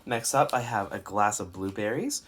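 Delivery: normal talking voice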